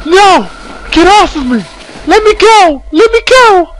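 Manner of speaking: shrieking high and loud while struggling; hysterical screaming. Acted. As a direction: fight someone off with falsetto screams. Acted June struggles, screaming.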